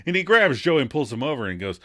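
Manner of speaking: ordinary talk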